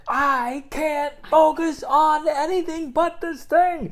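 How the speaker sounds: loud, high, drawn-out mock scream. Husband in pain to wife who wants love, screaming. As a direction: scream it slowly, every word high and loud. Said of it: "it's just gonna be screaming".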